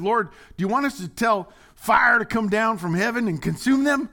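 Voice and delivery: high, loud disciples' plea; zealous shout. Acted. Disciples asking the Lord to send fire, zealous shout. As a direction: build it up to a fired-up demand. Disciples eager to burn village.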